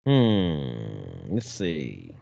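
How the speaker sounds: very low "hmm, let's see"; deep pondering voice. Pretend. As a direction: muse in a deep put-on voice. Deep thinking voice.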